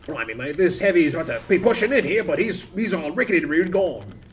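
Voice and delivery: mock British accent like the game's sniper; light British sniper impression. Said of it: "this actually sounds more like sniper".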